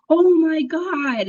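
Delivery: imitating a frightened boyfriend bursting in, drawn out; scared. Boyfriend rushing in after seeing a shadow, imitated scared. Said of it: "my boyfriend comes in scared".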